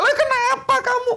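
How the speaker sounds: high-pitched toothache sufferer's outcry; mock yelling. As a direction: play a touchy patient scolding a child. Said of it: "Dia teriak".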